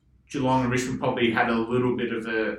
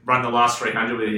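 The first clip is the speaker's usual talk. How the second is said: normal talking voice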